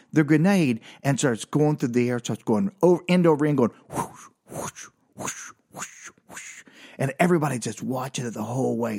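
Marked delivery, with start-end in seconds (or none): 3.9-6.6 soft airy whooshes, evenly spaced; hushed swish of air, repeated; whispered whooshing. Retold or imitated. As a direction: mimic something spinning through the air. Imitates grenade lever spinning through air.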